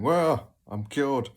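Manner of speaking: hammed-up self-satisfied tone; mock triumphant. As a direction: announce it slowly like a joke cure. Mock quote after crying.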